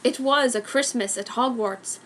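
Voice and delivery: usual speaking voice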